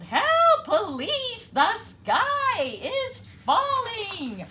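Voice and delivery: very high, loud, slow wail. A narrator's reading. Three birds wailing together for the police, loud. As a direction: wail for help with everything you have. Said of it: "the three birds wailed".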